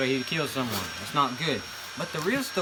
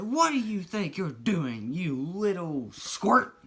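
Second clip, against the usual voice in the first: higher, slow, scornful put-on voice; scolding manager; 5 semitones higher, slower than usual. Manager scolding the grandson on the ground, slow and scornful. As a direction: talk down to him like a mean boss.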